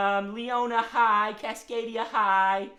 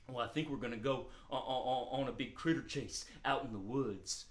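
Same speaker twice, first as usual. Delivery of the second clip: low, soft puppet dog voice; calm hound dog; 8 semitones lower, 8 dB quieter than usual. Pretend. Dog telling host his plans, mellow hound voice. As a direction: amble through it as a mellow old hound.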